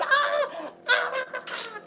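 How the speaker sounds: mock wasp panic squeal. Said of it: "and me going".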